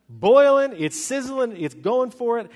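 punchy, high burst of excitement; high, loud opening word, easing slightly; excited. Acted. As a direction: build it up like water at full boil. Acts out the fiercely boiling water.

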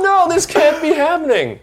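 loud, high comic wail; hammed-up horror, voice pushed way up; mock despair. Actor replays learning he got the job, mock despair. Joins in acting his own dismay.

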